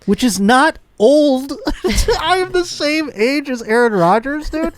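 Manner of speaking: mock outrage. Real, not acted. Protests being same age as Rodgers.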